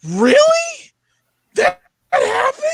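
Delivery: very high-pitched incredulous reaction; mock disbelief. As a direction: act out a stunned viewer, voice way up high. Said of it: "you're just gonna be like".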